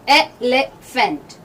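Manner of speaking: "एलिफेंट" very slowly in three parts, eh, le, fent; slow teaching split of "एलिफेंट"; syllable by syllable. Shown as a demonstration. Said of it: "ये एलिफेंट होता है".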